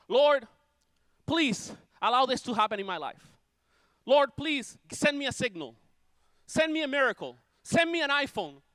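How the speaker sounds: high, insistent begging to God; mock pleading prayer. Preacher imitating believers begging God for miracles, high and pleading. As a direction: plead upward, one request after another.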